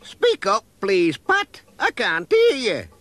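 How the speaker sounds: put-on old lady voice. A narrator's reading. Same old lady asks louder.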